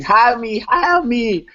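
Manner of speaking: high-pitched pleading mock yell; mock scream. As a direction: imitate someone screaming to be hired. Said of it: "Somebody is screaming".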